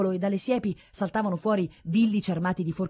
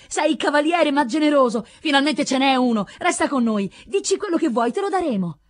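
high, loud, grateful outpouring; excited acclaim; 8 semitones higher, 6 dB louder than usual. A narrator's reading. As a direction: beg the hero to stay, all eagerness. Grateful villagers crowd round rescuer.

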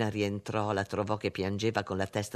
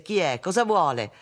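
louder, higher impatient questioning; exasperated demand; 5 semitones higher, 7 dB louder than usual. A narrator's reading. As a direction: demand to know who is calling. Exasperated demand to unknown caller. (domanda esasperata, a voce alta)

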